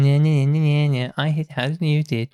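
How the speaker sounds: mildly sing-song bragging jeer; childish taunt. Acted. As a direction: tease them like a boastful kid. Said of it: "running around going".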